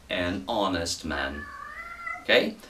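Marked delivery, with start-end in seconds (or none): 0.1-1.4 "An honest man" slow and soft, H silent; slow, soft model. Shown as a demonstration. Teacher repeating the model phrase slowly and softly for students. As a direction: spell it out slowly and softly for the class. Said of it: "soaria melhor".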